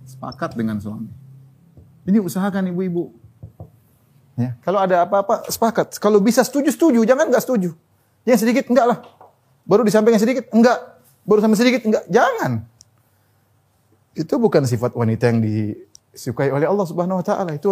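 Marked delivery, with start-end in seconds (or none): 2.0-12.7 raised, high insistent sermon voice; fervent preaching. Acted. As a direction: drive the point home to the women. Preaching at full force.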